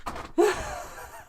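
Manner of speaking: squeaky giggle breaking out; high laugh. Real, not acted. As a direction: burst of high-pitched laughter. Laughs at own accent.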